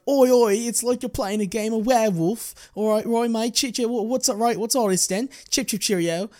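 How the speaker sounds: high, loud, hammed-up British accent; a cartoonish British accent impression of Andrew Garfield; mock British accent. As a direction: overdo a cheeky British bloke voice. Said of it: "This accent was, uh, irresponsible and offensive".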